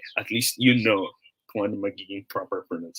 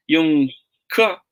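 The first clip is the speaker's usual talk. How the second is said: ordinary talk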